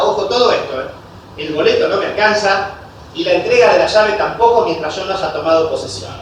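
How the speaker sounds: usual speaking voice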